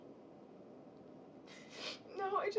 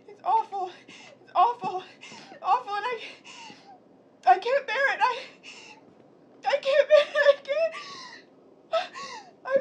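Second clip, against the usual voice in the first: louder and higher with each it's awful, laughter breaking through; rising hysterical outburst; 9 semitones higher, 10 dB louder than usual. Acted. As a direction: spiral from a choked laugh into a wail.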